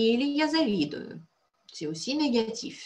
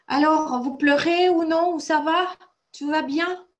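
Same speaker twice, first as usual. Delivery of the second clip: loud, high, lively check-in; raised voice, rousing the students with questions; calling out to pupils; 8 semitones higher, 8 dB louder than usual. Real, not acted. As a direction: call out brightly to the class. Teacher checks on pupils after sad words.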